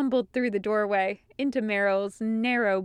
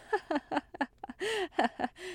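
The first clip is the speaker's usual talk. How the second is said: nervous laugh; 8 semitones higher, 7 dB quieter than usual. A narrator's reading. Nervous laugh after babble.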